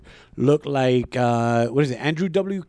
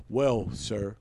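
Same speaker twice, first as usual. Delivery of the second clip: mock lecture, lower and measured; after-school-special lecture; 4 semitones lower, 6 dB quieter than usual. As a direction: imitate an officer delivering a patronizing moral lesson. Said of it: "give me an after school special speech".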